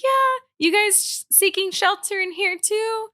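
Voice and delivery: mimicking a woman sheltering in the gym; lady at the gym. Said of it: "She's like".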